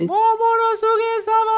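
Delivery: very high, loud, drawn-out cry; acted acclaim. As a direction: hail your lord in a stretched-out shout. Acts the crowd hailing their lord. (seruan memuja, melengking tinggi dan panjang)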